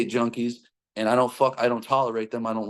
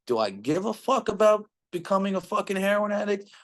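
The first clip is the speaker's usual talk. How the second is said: much higher-pitched inner debate; acted self-questioning; 9 semitones higher than usual. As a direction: retell the inner doubt in a raised, high voice. Debating with himself over trying heroin.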